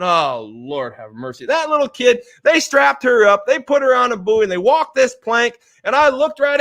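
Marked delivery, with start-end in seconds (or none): 0.0-1.4 low, slow retold groan of dread; mock dismay. Father hears his daughter will jump, mock dismay.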